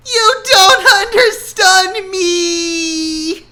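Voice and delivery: high, loud crying yell; hammed-up teen meltdown; tearful shout. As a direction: scream it through tears at dad. Teen daughter storms off.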